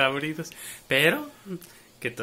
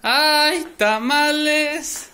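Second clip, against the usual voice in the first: loud, high, drawn-out vendor call; street vendor cry; 11 semitones higher, 12 dB louder, much slower than usual. Pretend. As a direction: hawk tamales like a street seller.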